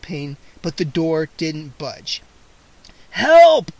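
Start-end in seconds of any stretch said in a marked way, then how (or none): 3.1-3.7 loud high desperate shout. A narrator's reading. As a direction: yell for help through the door. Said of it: "I shouted".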